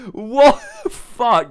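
loud, high, slow exclamation; shocked outburst. Real, not acted. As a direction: exclaim loudly in disbelief. Shocked at owl picture.

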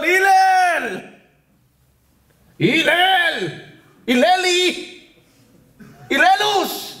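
high, drawn-out sing-song yells; calling out. Acted. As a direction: holler playful nicknames at someone inside. Calling Hillel out of the mikveh. (קריאות רמות ומתנגנות)

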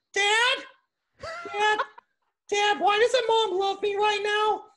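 squeaky mock whining at Dad; high-pitched kid voice. College friend playing a kid calling Dad, high-pitched whiny bit. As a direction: put on a very high, silly child voice. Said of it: "we would just talk really high pitch".

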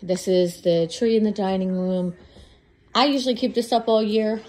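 normal talking voice

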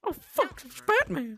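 loud, high-pitched playful alarm; mock panic. Player spotting Batman in the game, mock panicked yell. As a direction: blurt it out in hammed-up dread.

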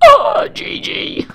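loud, very high ingressive squeal; slow gasped-in scream; breathing in while talking. Real, not acted. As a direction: shriek it on an inward breath. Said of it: "I breathed in while talking then".

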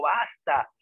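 mock rebel shout; retold cry of basta. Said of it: "un grito que dijo".

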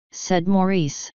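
ordinary delivery, nothing marked

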